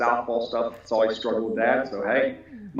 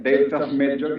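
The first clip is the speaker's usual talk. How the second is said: ordinary talk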